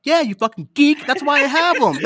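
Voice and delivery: high, loud joking rant; hammed-up outraged retort; mock-indignant comeback. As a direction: throw it back triumphantly, half laughing. Acted comeback to mocking cousin.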